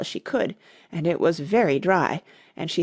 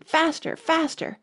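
high, urgent cry to hurry; 9 semitones higher than usual. A narrator's reading. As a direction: cry it out high, urging a runner on. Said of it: "cried the Queen".

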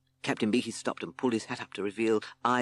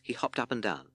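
plain reading voice

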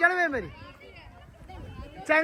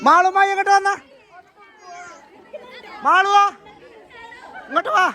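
calling out; 6 semitones higher than usual. Real, not acted. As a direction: shout her name so she hears. Calling Malu across the crowd.

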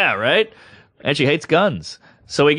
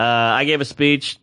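normal talking voice

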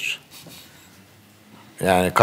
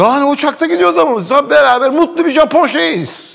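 high, excited "happy Japanese" delivery; Japanese TV show; 13 semitones higher, 5 dB louder than usual. Acted. Traveller hamming up being happy Japanese on a plane, high and excited. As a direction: play it like an over-cheery TV show guest. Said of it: "bayağı Japon televizyon programına katılmışsın gibi".

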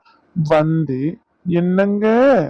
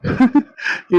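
a short high laugh; amused laugh; 7 semitones higher than usual. Acted. Husband laughing at his wife's fear, amused laugh. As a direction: laugh off her complaint.

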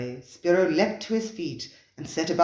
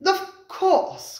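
high, loud exclamation; cried out; 5 dB louder than usual. A narrator's reading. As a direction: cry it out in sudden realisation. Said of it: "cried Lafleur".

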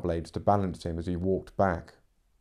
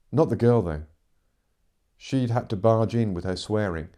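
usual speaking voice

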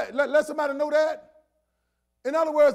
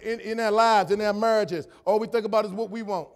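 ordinary delivery

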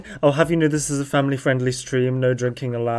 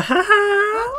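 high laugh. Real, not acted. Laughing at own joke.

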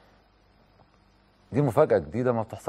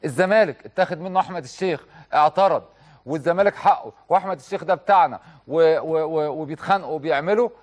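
normal talking voice

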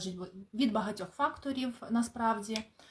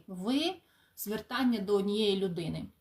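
ordinary talk, nothing marked

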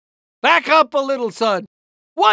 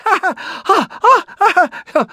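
nervous laugh; 8 semitones higher than usual. Acted. Nervous fit after scream.

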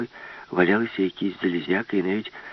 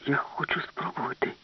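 softer, higher whisper; hushed; 6 semitones higher, 7 dB quieter than usual. Acted. Alice confides her plan to the professor in a hushed whisper. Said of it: "сказала Аліса".

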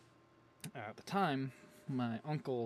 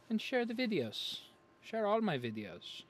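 drawn-out plea; 9 semitones higher, 4 dB louder, slower than usual. Acted. Begging viewers to share.

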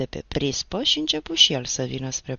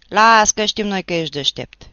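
loud, sarcastic jab; loud sneer; 4 semitones higher, 11 dB louder than usual. Acted. Neighbour sneers at the teacher across the yard, loud. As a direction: throw it at him loud.

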